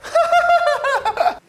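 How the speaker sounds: high burst of laughter. Real, not acted. Laser pops balloon.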